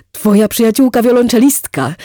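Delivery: loud, high delighted cry; delighted exclamation. Acted. Wit to Erika, thrilled to recall the cellist, delighted exclamation. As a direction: burst out with delight at the name. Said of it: "wykrzyknął z zachwytem".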